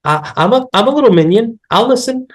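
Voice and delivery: high, pleading mock voice of an eager debtor; mock groveling borrower. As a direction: beg like an obedient little servant. Mocking a pleading debtor again.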